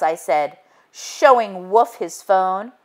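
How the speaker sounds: plain reading voice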